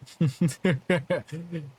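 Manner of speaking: mocking villain chuckle; villain laugh. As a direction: laugh down at him. Villain laughs at small foe.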